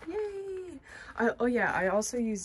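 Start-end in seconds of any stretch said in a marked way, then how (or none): 0.1-0.8 high, bright little yay cheer; excited cheer. Real, not acted. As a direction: squeal a happy yay. Cheering first load done.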